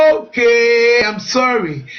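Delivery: drawn-out, resigned "okay", then a dropping apology; reluctant giving in. Retold or imitated. Husband finally apologizing after wife keeps asking, mock reluctant. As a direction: imitate a worn-down man stretching out his okay.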